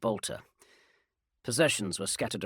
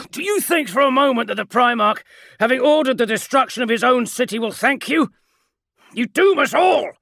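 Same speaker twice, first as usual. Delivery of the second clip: high, loud, ringing rebuke; furious sergeant; 14 semitones higher, 13 dB louder than usual. Acted. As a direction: warn them they are doomed.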